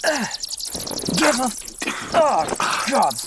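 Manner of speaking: high, panicked yelps; startled yelps. Acted. Graverobber attacked by bats while digging, panicked high yelps. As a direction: flail and cry out as bats swarm.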